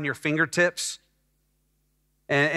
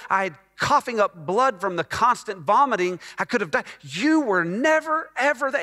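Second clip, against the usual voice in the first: building from hurt to a high, raised outcry; rising resentment; 4 semitones higher than usual. Retold or imitated. Son silently accusing his absent mother, building to a high cry. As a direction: pour out years of grievance, climbing in pitch.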